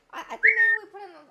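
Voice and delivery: normal talking voice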